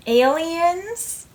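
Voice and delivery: thrilled gasp at a first sight; gasping surprise. Retold or imitated. Boy spotting aliens in space, gasping with delight.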